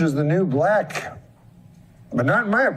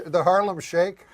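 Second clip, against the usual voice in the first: ordinary talk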